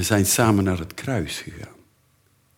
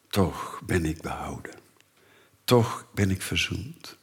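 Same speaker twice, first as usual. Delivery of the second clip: soft, lower whispered words of faith; hushed confession; 3 semitones lower, 7 dB quieter than usual. Acted. Believers whisper that they are saved, soft and hushed. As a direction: breathe it like a private prayer.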